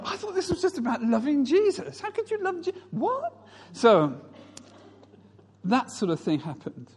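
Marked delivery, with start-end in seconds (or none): 0.0-3.3 high, bewildered protest; mock disbelief. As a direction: protest in squeaky, comic dismay. Acts dismayed reaction to people's lives.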